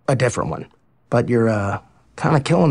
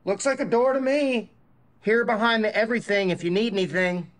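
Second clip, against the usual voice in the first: through a door; 7 semitones higher, 6 dB quieter than usual. Acted.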